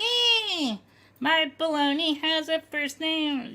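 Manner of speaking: very high squeaky imitation; helium voice. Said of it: "you did helium".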